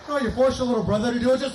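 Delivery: ordinary delivery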